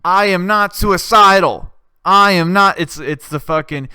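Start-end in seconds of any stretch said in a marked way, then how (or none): 0.0-2.8 louder, slow defiant declaration; mock defiant shout. Jussie in court yelling, loud defiant declaration. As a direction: proclaim it like a protest chant. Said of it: "he's like".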